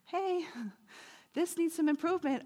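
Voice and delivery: high, soft nudging voice; Holy Spirit. Acted. Divine voice nudging the speaker to improve, high and soft. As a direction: gently point out a flaw, pitched high. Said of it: "where the Holy Spirit goes".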